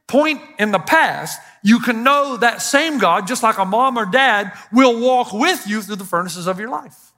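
an impassioned pulpit proclamation; preaching at full force. Acted. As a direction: preach it out to the whole room. Preaching to congregation.

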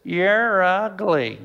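slow, flat parrot squawk of "You're ugly". Acted. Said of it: "the parrot says".

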